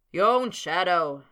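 loud, slow, harsh jeering reply; raucous reply. A narrator's reading. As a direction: bellow it out slowly. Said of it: "came the raucous reply".

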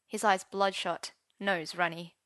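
ordinary delivery

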